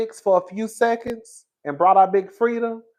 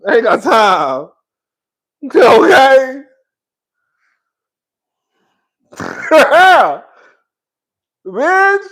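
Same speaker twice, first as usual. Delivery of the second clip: breathless excitement; 5 semitones higher, 7 dB louder, much slower than usual. Real, not acted. Winded after dancing, loud.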